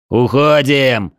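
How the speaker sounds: loud, long-drawn retreat call; shouted order. A narrator's reading. Fighter ordering his comrades to retreat, drawn-out shout. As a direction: call it out loud, stretching the word. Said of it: "услышал я крик Дроя".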